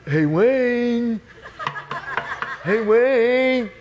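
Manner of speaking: pestering late-night caller; Mike. Retold or imitated. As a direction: call out with a long, drawn-out last word. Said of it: "I hear this voice".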